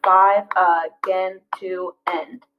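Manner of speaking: slow, each syllable spaced out; slow syllable-counting read. Shown as a demonstration. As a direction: tap out each beat. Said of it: "five syllables again".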